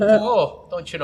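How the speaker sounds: loud, high Minnesota "oh, don't you know"; mock Fargo accent, drawn-out "oh". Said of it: "sound like they're on Fargo".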